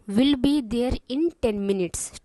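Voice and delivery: slow, even reading; slow and clear. Shown as a demonstration. Teacher reading the receptionist's answer slowly. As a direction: read it slowly for beginners. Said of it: "मैं इतना आराम आराम से इसलिए बोल रही हूँ".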